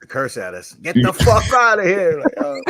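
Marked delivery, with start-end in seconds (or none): 0.8-2.2 higher, louder mock priest; mock cursing priest. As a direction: bark it like an angry priest.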